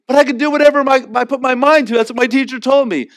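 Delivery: put-on high, loud, fast childish whine; mock a kid parroting his teacher; a hammed-up protesting child; whiny kid.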